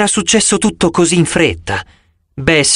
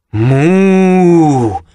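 moo; 4 dB louder than usual. Acted. Cow serpent speaks.

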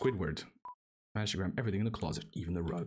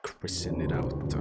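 lower, slow put-on bossy voice; mock deep command; 10 semitones lower, slower than usual. Ordering his friend Chris to get moving, in a lower, slow mock voice. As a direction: drag it out like a lazy boss. Bossing Chris around, silly.

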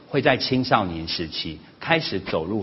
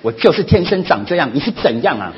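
loud, higher snap back with a laugh; angry retort; 5 semitones higher, 9 dB louder than usual. Retold or imitated. Mother snaps back at her son's criticism, loud and angry. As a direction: fire it back at him.

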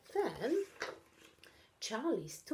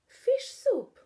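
high, much louder call; 10 dB louder than usual. A narrator's reading. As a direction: announce the dish with delight. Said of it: "called Katy".

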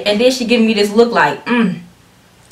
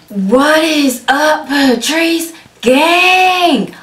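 high, long-drawn-out greeting; big drawn-out channel-opener shout; hype intro call; 6 semitones higher, much slower than usual. Real, not acted. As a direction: stretch each word out in a high hype call.

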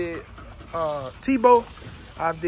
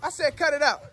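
higher, firm call to stop; warning dogs to quit; scolding the dogs; 5 semitones higher than usual. Real, not acted. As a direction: repeat the order, sharper this time.

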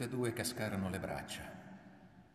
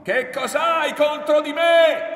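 high, very loud drawn-out shout; furious yell; 21 dB louder, slower than usual. A narrator's reading. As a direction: bellow it slowly with all your force. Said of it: "a occhi chiusi urlò".

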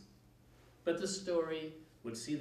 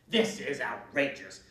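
loud, slow indignation; outraged witch; 7 dB louder than usual. A narrator's reading. Witch outraged as children climb her house. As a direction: huff it out, scandalized.

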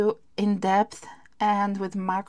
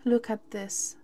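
usual speaking voice

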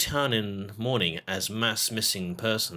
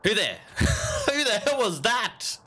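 startled and scared; 12 semitones higher, 3 dB louder, slower than usual. Real, not acted. Startled by someone behind.

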